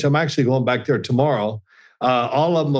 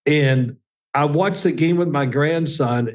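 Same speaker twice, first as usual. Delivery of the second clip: ordinary delivery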